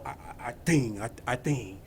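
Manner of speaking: low, soft Italian-American mobster mumble; mobster accent. Retold or imitated. Tony Soprano impression to the congregation, low mobster mumble. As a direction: mumble it like a New York mob boss. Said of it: "with that accent".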